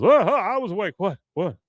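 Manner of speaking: high, loud jolt awake; startled awake. Retold or imitated. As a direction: jerk awake flustered. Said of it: "He goes".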